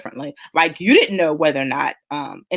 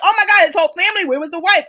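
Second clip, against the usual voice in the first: much higher, fast, rising alarm; horrified realization; 10 semitones higher, 4 dB louder, faster than usual. Retold or imitated. Learning his whole family was aboard, horrified alarm. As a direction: rush out the dawning horror. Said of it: "I was like".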